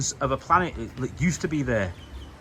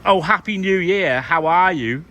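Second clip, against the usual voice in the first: mock cheerful greeting; 4 semitones higher, 6 dB louder, slower than usual. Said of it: "not like".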